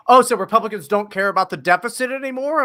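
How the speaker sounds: high, loud sneering mock question; mocking whiny voice. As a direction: mimic them with a sneer. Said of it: "They'd be like".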